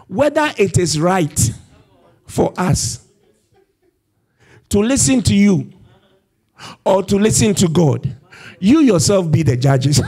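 retold fearless challenge; bold defiance. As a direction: stand up boldly to the judges. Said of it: "This is boldness".

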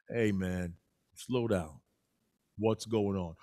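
a laid-back guy calming his friend; easygoing slow character. Acted. Laid-back buddy tells his excited friend to slow down, low and calm. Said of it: "One is easy going slow".

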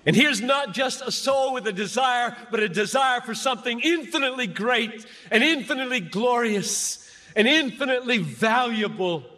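high, ringing pulpit build stressing infinitely; impassioned preaching. Acted. Preacher to students, soaring on infinitely. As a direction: preach it, each "infinitely" hammered.

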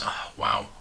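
breathy awed whisper, no voice; a soft voiceless gasp of wonder; whispered wow. Real, not acted. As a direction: hush it out in awe. Reacting to clearance three packs.

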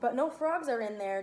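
usual speaking voice

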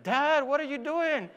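high-pitched indignant protest; mad son. Retold or imitated. Son objecting to dad grabbing the bar, indignant. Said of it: "he got so mad".

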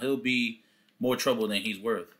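normal talking voice

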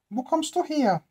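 high, put-on old woman voice; old woman's voice. Old woman greeting the tramp at her door, high put-on voice. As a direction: answer like a wary old crone, pitched high.

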